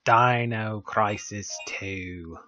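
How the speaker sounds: "Dino Crisis Two" drawn out very slowly and softly in a put-on accent. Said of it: "I don't know why I'm doing the accent".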